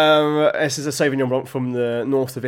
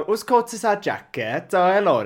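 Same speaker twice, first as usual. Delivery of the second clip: put-on, singsong Welsh accent; 5 semitones higher than usual. Shown as a demonstration. Trying an accent on co-host's request, put-on Welsh. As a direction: attempt a Welsh accent, pitched up. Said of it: "I could do a Welsh accent".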